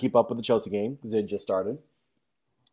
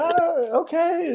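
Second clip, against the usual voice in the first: much higher, meek old-man imitation; a squeaky, compliant okay; high mock grandpa; much slower than usual. Grandpa agrees.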